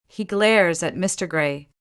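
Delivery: usual speaking voice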